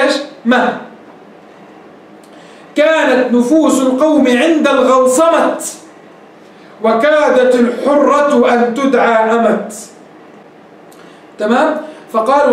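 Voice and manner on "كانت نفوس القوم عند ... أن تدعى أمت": slow recited verse; slow chanted poetry; poem recital. Shown as a demonstration. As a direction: chant the line slowly and evenly.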